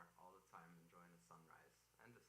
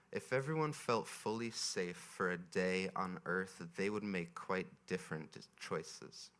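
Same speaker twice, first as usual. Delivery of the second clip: slow, measured reading to a crowd; reading aloud; 24 dB louder, slower than usual. Acted. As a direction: read it slowly, like a poem. Reads a passage to audience.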